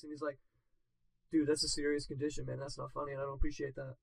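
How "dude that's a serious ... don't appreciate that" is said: low, quiet deadpan reproach; all serious. Retold or imitated. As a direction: scold him softly with a straight face. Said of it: "Jay looks at him all serious".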